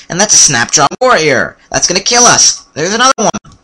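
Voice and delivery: high, rising cries of alarm. Pretend. Player spots deadly enemies in the swamp, alarmed. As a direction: call out the danger in playful panic. Enemy suddenly appears in game.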